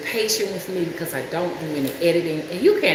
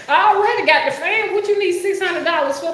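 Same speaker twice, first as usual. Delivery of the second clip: exasperated; 10 semitones higher, 3 dB louder than usual. Real, not acted. Ranting about fan price.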